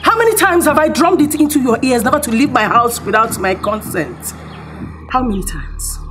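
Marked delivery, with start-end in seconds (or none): 0.0-4.3 loud and fast tirade; furious scolding. Acted. Madam scolding the girl for leaving without consent. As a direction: rattle off the rebuke at full volume.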